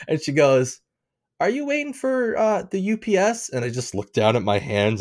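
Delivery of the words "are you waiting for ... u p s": high-pitched questioning customer; mimicking a woman's query in a raised pitch; imitated woman customer. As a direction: put on a high voice for her question. Imitates the woman asking him.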